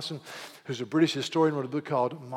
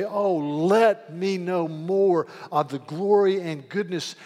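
fervent prayer; 4 semitones higher, 6 dB louder than usual. Retold or imitated. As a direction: pray it aloud as an earnest believer would. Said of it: "you say".

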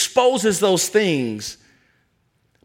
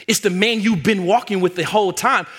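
ordinary delivery

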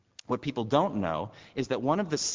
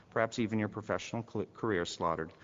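normal talking voice

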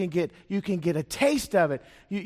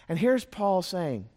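ordinary delivery

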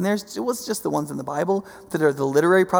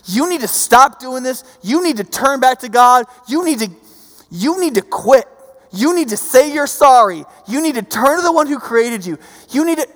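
loud, high preaching shout, "you need to" hammered; fiery prophet; 8 semitones higher, 9 dB louder than usual. Retold or imitated. Preacher voicing the fiery prophet warning Jerusalem, loud shout. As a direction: thunder a warning at the crowd. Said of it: "The fiery prophet who's like".

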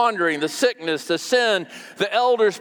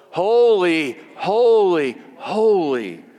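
louder, solemn proclamation, each word set apart; crying out; 4 dB louder, slower than usual. Acted. Seraphim crying out round God's throne, reverently proclaimed. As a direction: call it out in measured, ringing repeats. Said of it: "they're crying out, what?".

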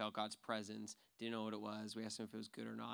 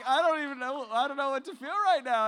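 giddy disbelief; 14 dB louder than usual. Retold or imitated. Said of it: "kinda laughing nervously".

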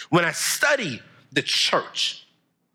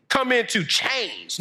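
ordinary talk, nothing marked

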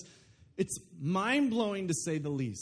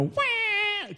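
high, drawn-out mock baby cry, much higher; imitated baby wail. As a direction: wail like a newborn, slowly.

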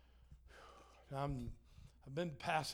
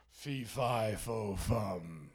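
a louder, lightly voiced giant's chant; 4 semitones lower, 5 dB louder, much slower than usual. Pastor playing the giant for the church, a louder, lightly voiced giant chant. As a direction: boom it out like a fairy-tale giant.

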